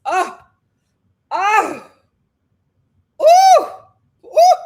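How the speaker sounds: high loud mock wail; wailing cries. Wailing in sympathy for Janae, high mock cries. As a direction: wail out in exaggerated grief.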